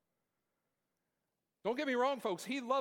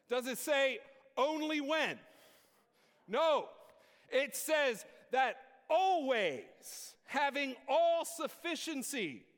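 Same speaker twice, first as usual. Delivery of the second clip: preaching at full force; 7 semitones higher than usual. Acted. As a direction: drive the congregation up to a climax. Call and response preaching.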